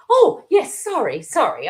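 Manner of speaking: high, loud startled apology; jump, then stammer out the sorry; flustered colleague. Retold or imitated. Caught-out colleague apologising, high and loud. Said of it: "Then they say".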